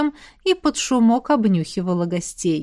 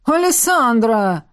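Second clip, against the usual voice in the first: недовольный вопль; slower than usual. Acted. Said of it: "недовольный вопль".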